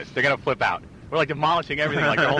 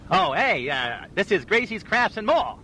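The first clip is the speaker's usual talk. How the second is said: a higher, lightly voiced game show host; Regis Philbin; 5 semitones higher than usual. A hint of Regis Philbin shopping for crafts at a store. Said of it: "I'm gonna be Regis Philbin".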